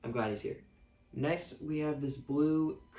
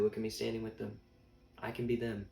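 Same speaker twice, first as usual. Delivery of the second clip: usual speaking voice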